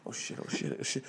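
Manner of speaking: whispered oh shit under the breath; panicked whisper. Retold or imitated. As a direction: hiss it, caught red-handed. Caught out, panicked hush.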